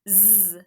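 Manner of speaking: "Z" as a sustained buzz, not the letter name; letter sound zzz. Shown as a demonstration. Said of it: "the first sound I would like".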